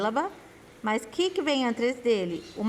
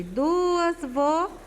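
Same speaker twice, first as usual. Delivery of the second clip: drawn out; 5 semitones higher, much slower than usual. Shown as a demonstration. Teacher stressing the rule.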